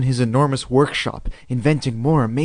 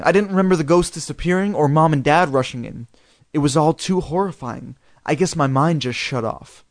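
plain reading voice

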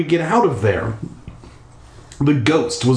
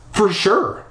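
high croak; 9 semitones higher than usual. Acted. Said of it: "I croaked".

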